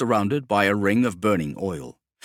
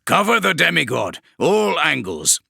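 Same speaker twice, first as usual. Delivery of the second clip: higher and louder barked battlefield orders; commanding warsmith; 7 semitones higher, 4 dB louder than usual. Acted. Kravarek orders his men to cover the demigod, loud and commanding. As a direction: bark it across the hold to your squads.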